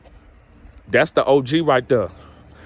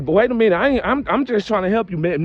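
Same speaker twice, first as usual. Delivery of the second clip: high, surprised protest; startled, insisting he only wants to help; taken aback; 8 semitones higher than usual. Retold or imitated. To a cousin who refuses help, high surprised protest. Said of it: "I'm like".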